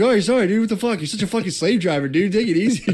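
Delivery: lightly hammed-up griping shout; mock yell. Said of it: "He would, like, yell at us".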